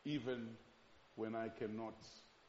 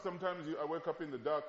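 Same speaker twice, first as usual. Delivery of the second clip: ordinary delivery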